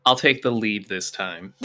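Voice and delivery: normal talking voice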